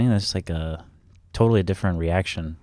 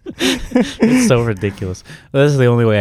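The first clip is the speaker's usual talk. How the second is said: laughing; 6 semitones higher, 4 dB louder than usual. Real, not acted. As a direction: laugh it out in disbelief. Laughing at his own habit.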